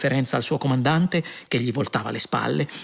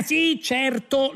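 high-pitched placating agreement; hasty interruption; 12 semitones higher, much slower than usual. A narrator's reading. Prisoner cutting short the guard's yell, high placating tone. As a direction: cut in high to humour him. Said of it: "lo interruppe subito Ford".

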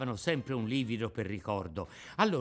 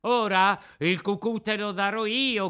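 high, louder threatening yell; angry shout; 8 semitones higher, 7 dB louder than usual. Acted. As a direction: bark the threat at the puppet. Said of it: "gridò il più ardito".

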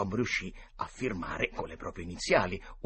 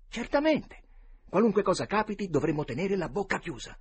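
emphatic assurance; 9 semitones higher, 6 dB louder than usual. A narrator's reading. Boy confirms the secret pact.

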